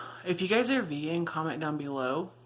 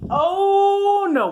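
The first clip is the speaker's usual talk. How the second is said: surprised; 9 dB louder than usual. Real, not acted. Sudden startled exclamation.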